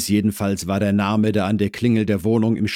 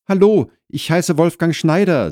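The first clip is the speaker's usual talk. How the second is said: put-on higher, louder young neighbour's greeting; Wolfgang introducing himself; 11 semitones higher, 6 dB louder than usual. New neighbour introduces himself at the door, eager and higher. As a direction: bring Wolfgang up above the narration.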